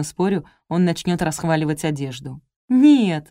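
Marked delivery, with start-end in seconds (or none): 2.7-3.3 loud, higher protesting no; firm objection. A narrator's reading. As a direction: object out loud, pitch jumping up. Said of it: "возразила я". (громкое возражение)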